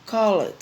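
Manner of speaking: called said as an English model word; English word demo. Shown as a demonstration. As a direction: give called its past-tense ending clearly. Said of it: "É como se eu coloco call". (pronúncia de "called" em inglês)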